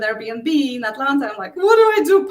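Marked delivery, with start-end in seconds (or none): 1.6-2.3 "What do I do?" pitched much higher and louder in panic; panicking. Retold or imitated. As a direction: cry it out in alarm at the trashed house.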